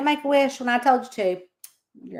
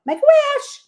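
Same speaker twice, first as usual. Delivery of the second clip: excited call; 7 dB louder than usual. Real, not acted. Calls out 1:11 wish moment.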